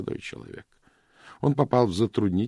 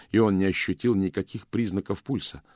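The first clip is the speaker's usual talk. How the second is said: ordinary delivery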